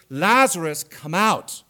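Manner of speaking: loud call into the tomb; commanding call. Acted. Jesus calling Lazarus out of the tomb, loud command. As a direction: call the dead man out with authority.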